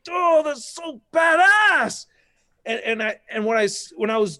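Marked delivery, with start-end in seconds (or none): 0.1-2.1 high, slow shout of delight; excited gushing. Retold or imitated. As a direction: squeal it like a thrilled fan. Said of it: "I was like".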